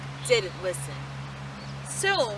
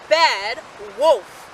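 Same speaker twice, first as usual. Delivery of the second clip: plain reading voice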